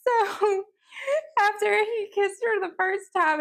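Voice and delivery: ordinary talk, nothing marked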